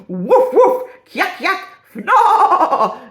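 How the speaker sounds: loud, high cartoon woofs and yelps; hammed-up barks building to a long loud cry; comic leering noises. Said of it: "that little rendition of all my skills".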